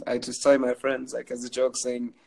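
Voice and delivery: ordinary talk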